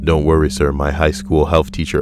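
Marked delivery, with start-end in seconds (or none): none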